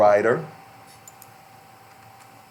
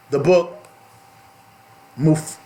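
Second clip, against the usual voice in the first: ordinary talk, nothing marked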